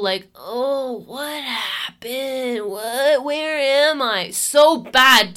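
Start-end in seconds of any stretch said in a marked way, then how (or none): 0.3-4.3 lightly hammed-up, higher, groggy just-woke-up act; mock dazed confusion. Retelling a hookup's fake amnesia the morning after, mock dazed. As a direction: play a guy faking he forgot where he is. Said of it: "he's doing this whole".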